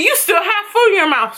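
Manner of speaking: put-on high scolding babysitter voice; mock-scold like the babysitter. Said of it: "And she went".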